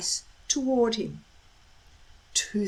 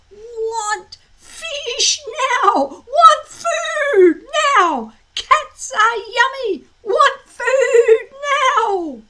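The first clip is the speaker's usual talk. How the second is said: high, loud, slow dragon shouts; shouting dragons; 14 semitones higher, 9 dB louder, much slower than usual. A narrator's reading. Dragons shouting for food while struggling wildly. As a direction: bellow it like a frantic hungry dragon. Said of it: "The dragons struggled wildly, shouting".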